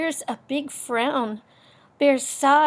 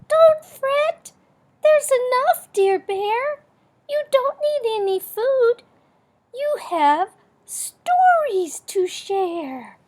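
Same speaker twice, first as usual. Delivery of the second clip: much higher, louder squeaky little mouse; 13 semitones higher, 6 dB louder than usual. Acted. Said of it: "Mouse squeaks".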